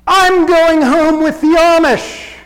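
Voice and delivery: loud, high and drawn-out proclamation; determined announcement. Retold or imitated. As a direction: announce it with total determination, slowly. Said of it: "announced with a determined, uh, tone in her voice".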